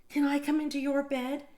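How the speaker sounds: high, small pleading mouse voice; Little Mouse voice. Acted. Little Mouse asks again.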